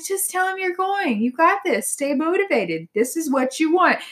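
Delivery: lightly voiced sweet angel, high; a hint of a sweet encouraging angel. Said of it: "the angel's like".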